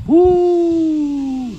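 high hooting woo like wind; sermon sound effect wind howl. Shown as a demonstration. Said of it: "Everybody go".

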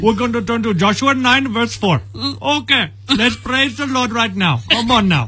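put-on high, bright Indian-accented preacher greeting. Preacher greeting an Indian church in an Indian accent. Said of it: "preach in an Indian accent".

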